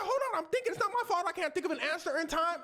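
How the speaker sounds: high, rushed scramble; flustered stalling. Retold or imitated. Mother stalling police for an excuse, fast high scramble. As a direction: gabble it fast and high, no answer ready.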